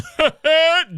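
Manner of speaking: high-pitched burst of laughter. Real, not acted. Host recalls his bust video, high laugh.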